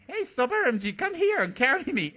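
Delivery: put-on high, loud toddler demand; loud high call to be picked up; child's scream. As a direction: shout it like a bossy little boy. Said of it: "he would scream at me".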